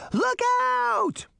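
very high, drawn-out warning cry; alarmed shout. A narrator's reading. Daphne warning of the fence ahead, shouting in alarm.

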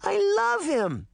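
very high, slow gush of love; little girl melting over a bunny; drawn-out delighted gushing; adoring squeal. Acted.

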